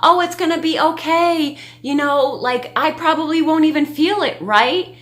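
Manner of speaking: a hint of a brave seven-year-old; light mock child reassuring herself; imitating Nova. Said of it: "She's like".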